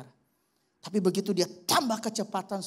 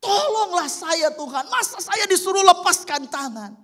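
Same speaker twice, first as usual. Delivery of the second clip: desperate loud pleading; 11 semitones higher, 8 dB louder than usual. Retold or imitated. Man pleading, refusing to let go.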